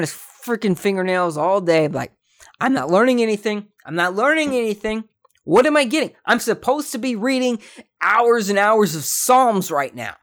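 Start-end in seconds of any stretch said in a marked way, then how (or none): 2.6-10.1 a mock complaining kid, higher and louder; whiny snitch kid. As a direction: whine it like a fed-up student tattling. Imagined snitch kid whining.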